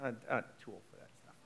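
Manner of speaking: a lightly voiced weary old self, fast and mumbled; muttered, defeated old-age excuse; tired old-timer voice. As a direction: grumble it like you've given up. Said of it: "when I go like this".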